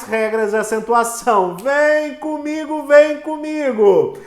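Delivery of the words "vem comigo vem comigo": eager call to follow. Real, not acted. Teacher rallying the class.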